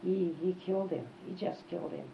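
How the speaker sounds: ordinary talk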